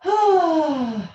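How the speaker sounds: a big, loud, open sigh with the voice high. Shown as a demonstration. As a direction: let it all out in one big sigh. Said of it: "just let out a sigh".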